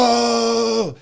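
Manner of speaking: high, drawn-out mock gasp of "oh"; imitated amazement. Bodybuilder mimicking the reaction at prejudging, high mock amazement. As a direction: imitate a crowd suddenly impressed.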